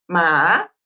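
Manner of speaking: long "ma" dipping low, then rising; careful Mandarin "ma" swept up from low; tono chino. Shown as a demonstration. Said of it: "espero haberlo pronunciado bien". ("ma" grave que sube al final)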